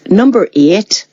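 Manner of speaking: Northern Irish accent on a short phrase; attempted Northern Irish. Shown as a demonstration. Actor showing the dialect she finds hard, attempted Northern Irish accent. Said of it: "can't do the Northern Irish".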